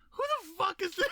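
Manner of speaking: high, incredulous yelled question; mock shout. Stranger baffled by the host, yelling high. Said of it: "people are probably just gonna go".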